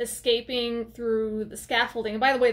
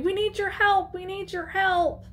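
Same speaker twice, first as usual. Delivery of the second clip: retold scream for help; 8 semitones higher than usual. Maya runs through the woods for help, mock scream. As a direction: plead in a raised, half-hearted scream. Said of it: "She's screaming".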